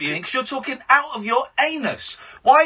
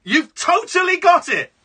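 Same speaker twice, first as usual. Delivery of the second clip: sarcastic shout; 7 semitones higher than usual. Real, not acted. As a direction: yell it back sarcastically. Sarcastic shouting back at caller.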